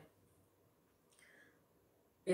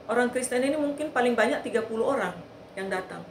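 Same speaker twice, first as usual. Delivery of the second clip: ordinary delivery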